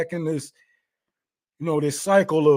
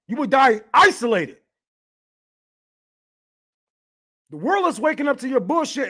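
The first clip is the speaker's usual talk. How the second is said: angry rant; 8 semitones higher than usual. Real, not acted. Streamer ranting at gloating posters, angry and raised. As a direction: tear into them with rising fury.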